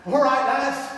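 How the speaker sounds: loud call to the lads; rallying shout. Acted. Football fan calling his crew into the fight, loud rallying shout. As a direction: rouse the boys for the fight.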